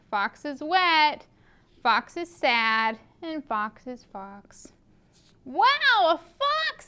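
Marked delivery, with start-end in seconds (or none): 5.4-6.9 high, squeaky amazed squirrel voice; excited squirrel. A narrator's reading. Reading to kids, Squirrel amazed at seeing a fox. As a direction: gasp it out in wonder. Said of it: "says Squirrel".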